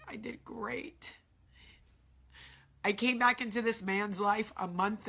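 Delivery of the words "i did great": slow, much softer sarcastic aside; a quiet, dry jab at herself. Real, not acted. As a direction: mutter "I did great" slow and soft, self-mocking. Ironic self-assessment.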